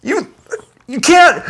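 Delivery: ordinary talk, nothing marked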